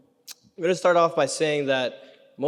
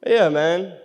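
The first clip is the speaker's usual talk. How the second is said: ordinary talk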